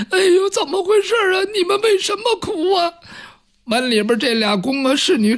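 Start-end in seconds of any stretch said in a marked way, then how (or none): 0.1-2.9 high, fast, whimpering maid voice; tearful palace maids. A narrator's reading. Maids outside asking those inside why they weep, tearful. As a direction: wail the question in a squeaky sob. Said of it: "他们在那抽抽搭搭的哭".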